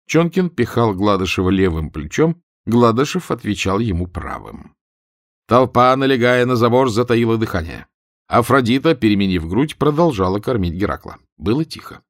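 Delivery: ordinary delivery, nothing marked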